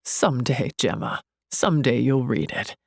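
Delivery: low, teasing man's line. A narrator's reading. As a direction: drop the pitch to voice the man. Male friend's line in narration.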